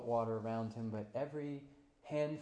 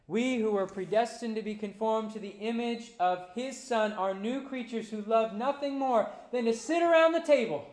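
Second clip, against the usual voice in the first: rising, loud preacher's cry; full-force preaching; 10 semitones higher, 13 dB louder than usual. Acted. Pastor to congregation, preaching at full force. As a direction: lift the room toward the table.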